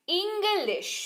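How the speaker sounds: इंग्लिश with a short i and the stress on the first syllable. Shown as a demonstration. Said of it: "देखिए जोर पड़ रहा है".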